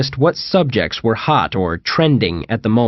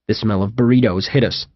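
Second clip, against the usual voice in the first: ordinary delivery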